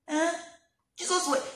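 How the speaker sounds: ordinary talk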